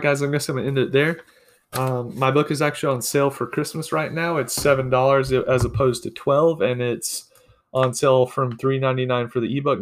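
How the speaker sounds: plain reading voice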